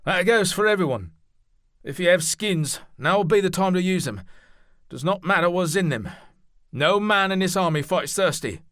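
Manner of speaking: calling out loud and high to all the men; a loud, ringing call to the troops. Acted. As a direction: project it so the whole army hears. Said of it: "Then Noel raised his voice".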